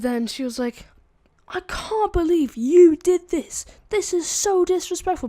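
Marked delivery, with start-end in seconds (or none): 1.5-5.3 high, loud, drawn-out scolding; tearing into them. Retold or imitated. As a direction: imitate an outraged principal. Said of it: "Basically just tearing into us".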